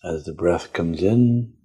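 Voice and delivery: slow, calm meditation guide voice; guided meditation. Acted. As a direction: lead them gently inward, unhurried.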